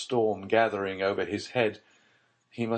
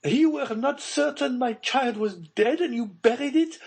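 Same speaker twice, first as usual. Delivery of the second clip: much higher, louder voice of a frightened mother; Baroness voice; 6 dB louder than usual. Acted. Baroness demanding of Villefort about her buried child, high and frightened.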